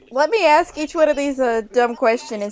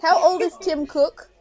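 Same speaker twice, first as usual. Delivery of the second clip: talking to voice assistant; 3 semitones higher than usual. Real, not acted. Slow question to Siri.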